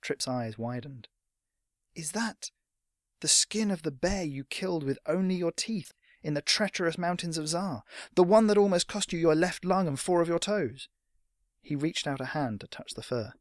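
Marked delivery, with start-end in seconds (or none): 1.9-10.9 high, building excited lad; awestruck boy. Acted. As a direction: gush like a young fan. Awed boy recalls hero's legend.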